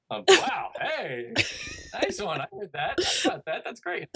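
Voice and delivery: put-on high, bright-eyed cheering for a toddler; gushing praise. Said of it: "and I'm".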